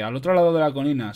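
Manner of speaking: usual speaking voice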